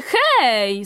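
mild indignant call; called-out objection. A narrator's reading. Another quail interrupting the count, calling out. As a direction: call out to cut someone off. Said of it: "zawołała inna".

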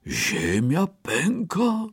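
high, slow disbelieving elephant; startled exclaim. Acted. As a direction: gasp out the news. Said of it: "wykrzyknął". (zdumiony okrzyk słonia)

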